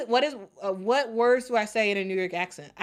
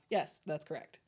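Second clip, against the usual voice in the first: ordinary delivery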